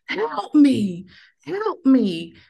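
high, loud desperate call for help; frantic cry for help. Retold or imitated. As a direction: cry out for your lost child. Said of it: "I remember just screaming".

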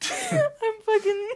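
high, slow, breaking with laughter; cracking up mid-word; laughing. Real, not acted.

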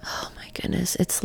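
breathless whispered awe; awed whisper. Real, not acted. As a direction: whisper it, overwhelmed. Awestruck at expanded reality.

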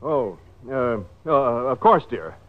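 low, halting, awkward agreement; reluctant hesitation. Acted. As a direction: hem and haw before giving in. Reluctant fake husband asked to kiss.

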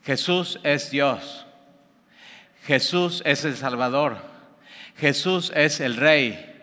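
slow, deliberate declaration; slow proclamation. Retold or imitated. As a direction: announce each phrase slowly, with weight. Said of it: "Pablo llega y ¿qué dice?".

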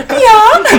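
real laughing squeal; laughing yelp. Real, not acted. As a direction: burst out laughing on the word. Laughing outburst at voice question.

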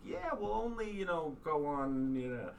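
high-pitched, drawn-out hedging; mock placating. Husband promising wife a relaxing trip, mock placating.

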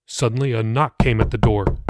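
plain reading voice

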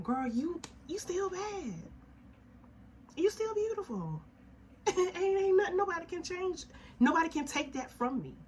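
high-pitched laughing self-praise; high excited self-hype. Real, not acted. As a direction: squeal it to yourself, beaming. Hyping herself up, laughing.

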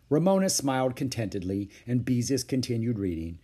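plain reading voice

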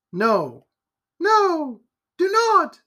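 high, loud frightened plea; the lady begs him not to go, high and loud; pleading cry. A narrator's reading. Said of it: "cried the lady".

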